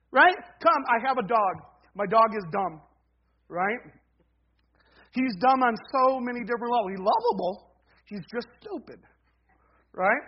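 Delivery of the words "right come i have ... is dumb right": ordinary talk, nothing marked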